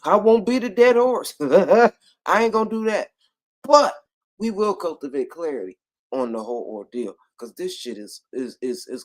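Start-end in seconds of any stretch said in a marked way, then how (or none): 0.1-3.1 high, laughing through it; amused high aside. Real, not acted. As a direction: chuckle it out.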